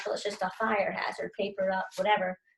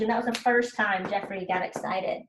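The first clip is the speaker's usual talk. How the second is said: normal talking voice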